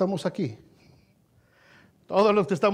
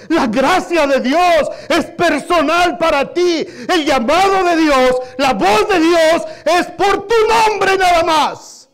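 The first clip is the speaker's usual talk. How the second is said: rising to a loud preaching shout; 7 semitones higher, 8 dB louder, slower than usual. Real, not acted. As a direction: drive it home, loud and slow. Predicación con fuerza. (predicación a gritos)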